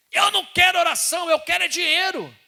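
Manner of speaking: high, loud furious yell; angry shout. Retold or imitated. Beggar yelling at the pastor for money, angry shout. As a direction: bellow the demand for money.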